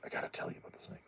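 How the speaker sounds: hushed, urgent whisper; whispered hyrax voice. Retold or imitated. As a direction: pass on the snake warning in a whisper.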